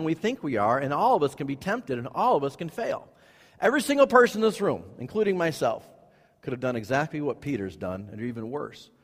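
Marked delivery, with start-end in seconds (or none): 3.6-5.9 quick, raised and urgent; fast, urgent emphasis. Real, not acted. Preacher urging the whole room, fast and raised. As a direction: press it on every listener.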